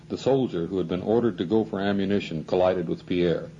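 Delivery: usual speaking voice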